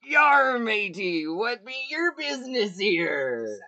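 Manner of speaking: loud, slow gruff-free pirate hail. Acted. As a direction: hail them like a swaggering pirate. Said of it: "launch into my pirate voice".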